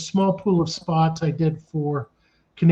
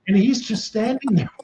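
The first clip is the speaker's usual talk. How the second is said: usual speaking voice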